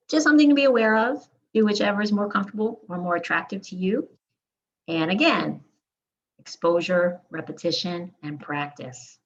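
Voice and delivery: ordinary talk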